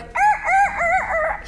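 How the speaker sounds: high squawking mock turkey calls; hammed-up desperate turkey calling; desperate turkey call imitation. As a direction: squawk like a frantic guide calling birds back. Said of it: "He's trying to call them back".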